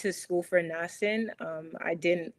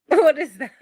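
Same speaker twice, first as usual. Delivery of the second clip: startled; 9 semitones higher, 13 dB louder than usual. Retold or imitated. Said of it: "I'm like".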